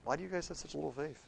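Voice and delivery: soft chiding. Acted. Jesus chides disciples softly.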